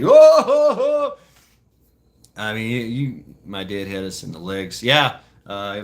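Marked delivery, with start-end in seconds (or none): 0.0-1.2 high, loud mock sobbing howl; hammed-up kid's wail of agony; pained wailing. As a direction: howl in comic pain, imitating a child. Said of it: "you're like".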